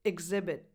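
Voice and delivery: exhibit said as ig-ZI-bit, X voiced gz. Shown as a demonstration. Coach demonstrates exhibit with a voiced gz. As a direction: say exhibit with a buzzing gz, not ks. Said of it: "The second sound it can have is this one".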